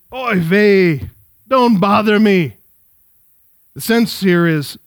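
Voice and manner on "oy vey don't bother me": put-on light Yiddish accent, slow and grumbling; a hint of a Fiddler on the Roof papa.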